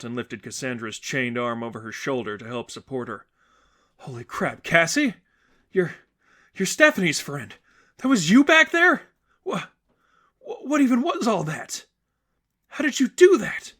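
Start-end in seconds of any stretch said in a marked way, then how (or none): 4.0-13.7 high, loud amazed voice; overwhelmed with awe; astonished. Acted. Sadie astonished on the shore.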